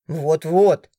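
a man chiming in, outraged; indignant man. A narrator's reading. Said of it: "это возмущался уже мужчина".

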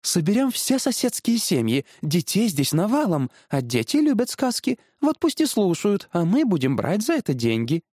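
high, excited sales pitch; scheming wife. Acted. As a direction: lay out the money-making plan eagerly. (увлечённый высокий женский голос)

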